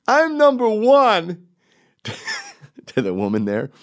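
very high, loud, drawn-out character yell; hammed-up high-pitched boast; Timmy. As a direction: shout it slowly in a high put-on voice. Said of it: "I was enjoying being Timmy".

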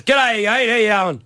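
put-on broad Aussie accent, slow; Australian accent. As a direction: lay on a thick Australian accent. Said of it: "I put on a really, uh, big Australian accent".

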